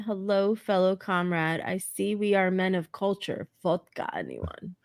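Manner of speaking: lightly voiced Russian comrade; Russian accent. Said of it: "In Russian accent".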